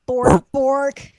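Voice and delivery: loud mock barking bork; dog voice. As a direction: bark it like a big dog. Voicing the dog.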